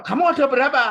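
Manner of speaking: high, loud questioning; mock interrogation. Friend interviews the demon, loud mock interrogation. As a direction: demand it like an exorcist grilling a demon.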